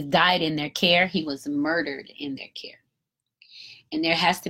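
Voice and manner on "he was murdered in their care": breathy whispered, lower and softer; hushed whisper. Real, not acted. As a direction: drop to a whisper on the murder. Grave point about the killing.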